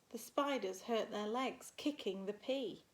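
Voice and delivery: ordinary delivery, nothing marked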